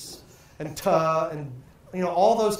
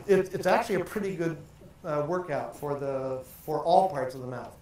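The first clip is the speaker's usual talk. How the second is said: ordinary talk, nothing marked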